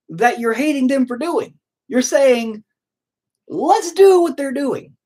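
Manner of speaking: high, exasperated mock retelling of his own reply; incredulous retort. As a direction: push the disbelief up high, as if talking to a dimwit. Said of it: "And I go".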